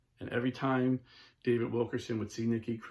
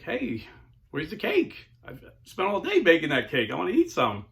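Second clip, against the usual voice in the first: indignant; 6 semitones higher, 6 dB louder than usual. Retold or imitated. Pastor asking for cake.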